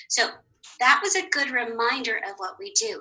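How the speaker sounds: ordinary talk, nothing marked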